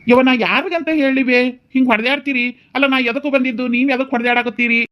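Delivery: high-pitched, exasperated wail; exasperated protest. Acted. Son caught between fighting mother and wife, exasperated protest.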